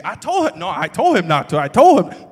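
loud, high I told him, building; indignant exclamation. Retold or imitated. Pastor acting out gossip about his brother, loud and indignant. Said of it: "I call up Ro".

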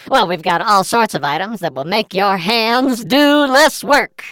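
high, bright salesman pitch. Pretend. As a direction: sell it like an infomercial host. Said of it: "That's how you say it".

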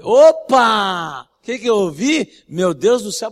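loud, pouncing exclamation; playful loud gotcha; mock surprise. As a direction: catch him out on what he just said. Preacher retells catching his words.

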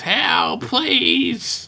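high-pitched imitation of screaming for help; mock scream. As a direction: plead at a mock top-of-lungs pitch. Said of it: "screaming at the top of his lungs".

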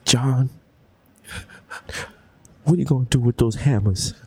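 lightly voiced wife with long pauses; a hint of dramatic wife; worried wife. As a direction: lightly play a film wife questioning her husband. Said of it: "Just see the wife like".

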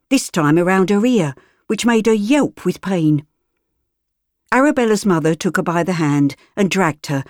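ordinary delivery

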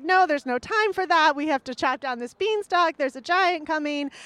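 mock-panicked call to action; urgent warning. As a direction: cut her off and rally her to act. Jack urgently warns his mother.

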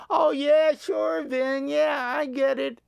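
high, dismissive mock skeptic; muttering skeptic. Skeptic in the crowd dismissing Vin, mock dismissive voice. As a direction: brush him off with a smirk. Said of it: "still chuckling, still muttering".

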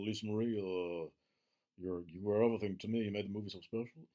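lightly voiced [name]-style tender tribute; a hint of [name] crooning his thanks; imitating [name] drawl. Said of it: "He's all".

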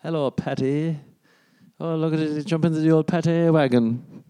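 high, silly send-up of the narrator; mock Irish-teasing voice. Mimicking a video narrator who seems to mock Irish people, high and silly. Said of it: "he's taking a piss out of Irish people".

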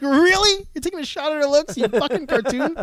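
hammed-up disbelief, voice jumping high; mock outrage. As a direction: act offended at a cartoon. Mock indignation at the cartoon.

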